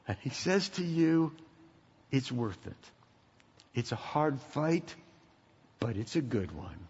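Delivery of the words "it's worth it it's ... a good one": soft, measured phrases with pauses; quiet encouragement. Retold or imitated. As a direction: gently reassure them, voice softened. Voices Paul cheering from stands.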